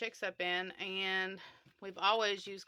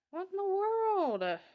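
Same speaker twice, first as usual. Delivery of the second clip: shocked; 12 semitones higher, 4 dB louder, slower than usual. Real, not acted. Chick suddenly dying in hands.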